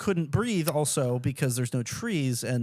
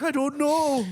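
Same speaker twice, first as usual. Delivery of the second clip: scared Trudeau; 11 semitones higher, slower than usual. Retold or imitated. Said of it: "He's like".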